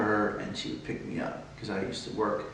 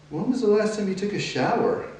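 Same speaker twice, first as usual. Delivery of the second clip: higher-pitched mom's question; mom's probing question, pitched well up; imitating mom; 10 semitones higher than usual. Imitating mom questioning his hygiene.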